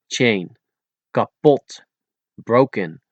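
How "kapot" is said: Kapot with no puff on K, P or T; unblown K, P, T. Shown as a demonstration. Teacher showing Dutch letters, Kapot with unblown consonants. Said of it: "without blowing those three letters".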